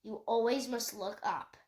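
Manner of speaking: ordinary delivery, nothing marked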